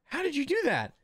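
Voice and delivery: put-on high-pitched kid's wonder; very high, astonished child voice; amazed child. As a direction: ask like a little boy stunned by magic. Little kid amazed by magic trick.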